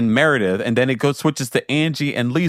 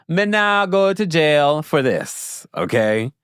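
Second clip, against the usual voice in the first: put-on slow, higher-pitched Jamaican accent; Jamaican patois; 3 semitones higher, slower than usual. Host refusing to risk jail over taxes, in Jamaican patois. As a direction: stretch it out in emphatic Jamaican patois.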